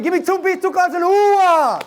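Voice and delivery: high chanted call to the crowd; hype call. Shown as a demonstration. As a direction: lead the chant, pumping them up. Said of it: "we're gonna try it again".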